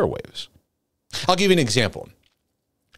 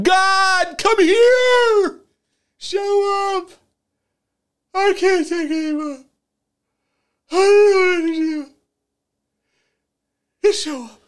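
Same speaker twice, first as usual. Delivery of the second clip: desperate yelling prayer. Retold or imitated. Preacher acts out an overwhelmed believer yelling at God: high, frantic. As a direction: shout up at heaven like you can't take any more. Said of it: "And we yell".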